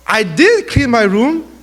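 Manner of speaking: "did" drawn out and punched; stress on did. Retold or imitated. Child insisting to mom the chore was done, "did" stressed. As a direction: lean hard and slowly on "did".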